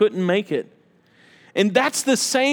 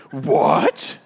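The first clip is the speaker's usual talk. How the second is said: low, disbelieving question; stunned question with a rising end; incredulous; 7 semitones lower than usual. Acted. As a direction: react in low-voiced disbelief. Shocked listener in acted dialogue.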